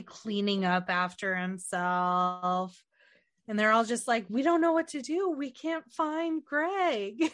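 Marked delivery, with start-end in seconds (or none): 4.3-7.3 mock helpless whine. Friends retelling a spoof movie scene, mock helpless. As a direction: put on a helpless plea, stretching the end. Acted quote of clueless characters.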